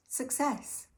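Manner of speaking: high, bright cry of triumph; excited. Real, not acted. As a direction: celebrate a small win. Triumphant after getting drink home.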